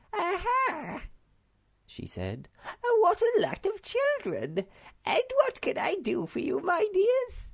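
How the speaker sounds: put-on very high, loud, twittering old shopkeeper; little old lady. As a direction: greet the children in a bright squeaky voice. Old shopkeeper greeting children.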